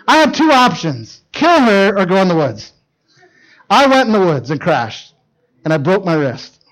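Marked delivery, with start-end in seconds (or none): none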